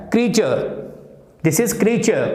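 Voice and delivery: "creature" as KREE-chuh, silent r; two-syllable model "creature"; correct pronunciation. Shown as a demonstration. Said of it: "इसी प्रकार से ये क्या है".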